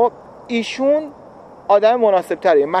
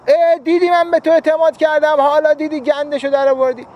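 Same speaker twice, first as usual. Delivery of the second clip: raised, scolding told-you-so; mock reproach; 8 semitones higher, 3 dB louder than usual. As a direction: rub the mistake in, playfully. Mock reproach after broken trust.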